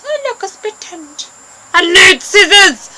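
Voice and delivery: high, thrilled gasp at a split end, then loud cry for scissors; mock excited discovery. Girl hamming up spotting a split end, excited. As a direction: play up finding a split end.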